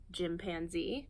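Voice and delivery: usual speaking voice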